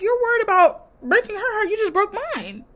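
high-pitched indignant comeback; indignant retort. Retold or imitated. Retelling a hurt woman confronting her man, indignant.

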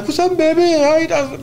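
high-pitched, jaunty funk-star greeting; imitated musician's playful hello. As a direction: greet them like a flamboyant funk legend. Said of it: "And [name]'s like".

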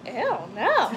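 imitating mom's grossed-out refusal; retold "ew", played for laughs; mock disgusted refusal. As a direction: act out a squeamish little no. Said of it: "Exactly how I said it".

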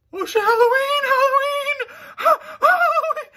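very high, loud squeaky Halloween cry; silly squeaky voice. Pretend. Interrupting himself about Halloween in a squeaky cartoon voice.

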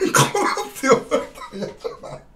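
high, breathy laugh through the words; giggling repeat of the complaint. Real, not acted. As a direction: crack up halfway through the question. Repeats the question while laughing.

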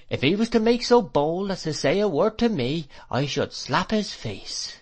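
high-pitched protest, slowing for the threat; indignant. A narrator's reading. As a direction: huff it out as an affronted servant. Servant indignant about her suitor.

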